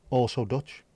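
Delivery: ordinary talk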